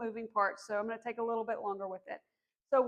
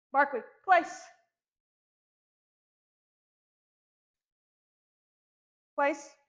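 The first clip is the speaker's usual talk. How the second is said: louder command to a puppy; firm dog command; 3 semitones higher, 4 dB louder, slower than usual. Real, not acted. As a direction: give the dog a firm cue.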